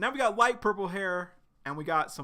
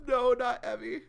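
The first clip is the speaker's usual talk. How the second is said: high, louder wailing protest; high, hammed-up tearful no; crying voice; 8 semitones higher, 4 dB louder than usual. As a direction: cry it out in a high voice. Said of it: "and Evie's crying".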